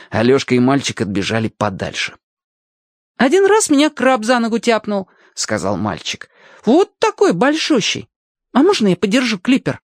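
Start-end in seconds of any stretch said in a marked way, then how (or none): 3.2-5.1 put-on high-pitched boy's voice; lively boy. Boy explains the winds to his new friend, high boyish voice. 6.6-9.8 put-on high-pitched boy's voice; lively boy. Boy explains the winds to his new friend, high boyish voice.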